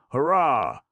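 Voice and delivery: high, loud triumphant cheer; exultant cheer. Acted. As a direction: cheer in sheer joy. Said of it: "exulted the little gnome".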